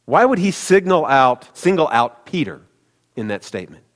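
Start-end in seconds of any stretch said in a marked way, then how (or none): none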